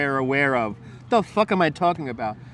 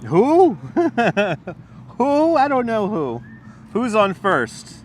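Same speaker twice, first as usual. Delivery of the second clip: mock confused who; 6 semitones higher, slower than usual. Joking Who's on first bit.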